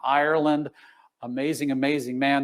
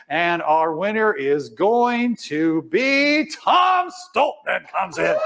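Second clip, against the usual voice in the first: announcing winner; much slower than usual. Real, not acted.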